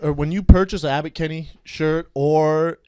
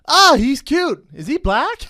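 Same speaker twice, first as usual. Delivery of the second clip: higher-pitched quote of a text; lightly voiced friend; 7 semitones higher than usual. Friend texting back about his photo, lightly imitated. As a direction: lightly act out her gushing reply. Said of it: "her friend texts back, goes".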